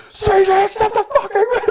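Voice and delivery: high furious yell at a teammate; retold scream. Gamers screaming at an AFK teammate, furious high yell. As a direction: scream it at the guy who won't respond. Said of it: "the whole group of us are screaming at him".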